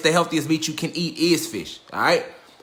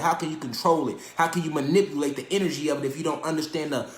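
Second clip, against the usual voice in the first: ordinary talk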